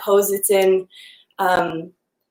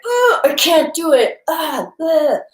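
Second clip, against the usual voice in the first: higher, mock defeated whine; frustrated whine; 7 semitones higher, slower than usual. Yoga student giving up on a shoulder stretch, mock whine. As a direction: give up with a hammed-up groan. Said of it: "Instead of being like".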